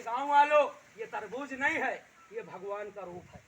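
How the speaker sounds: retelling the officers calling out loudly to the crowd; mock shout. Officers announce the miracle to villagers, in a loud mock shout. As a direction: proclaim it to the village like an amazed officer. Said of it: "चिल्ला के कहने लगे".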